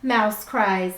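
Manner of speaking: slow, lilting storybook voice; reading to children. A narrator's reading. Teacher reading a picture book to her class, gently storytelling. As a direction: read it like bedtime story time.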